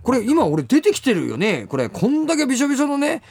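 retelling his protest to the guard, mildly exasperated; incredulous protest. Retold or imitated. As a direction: lightly act out arguing the obvious. Arguing with security guard.

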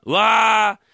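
loud, high whoop of praise; admiring cheer. Acted. 長屋の仲間がてっつぁんの口上に感嘆する歓声. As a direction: cheer him on.

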